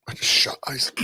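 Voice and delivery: coughing after swallowing ice; strained, choking on an ice cube; choked on ice. Real, not acted. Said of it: "Shot ice on my throat".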